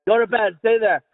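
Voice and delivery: ordinary talk, nothing marked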